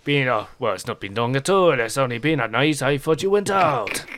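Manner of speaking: jovial innkeeper cheer; Pierre. Acted. Pierre voiced by GM.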